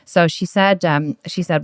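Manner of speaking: ordinary talk, nothing marked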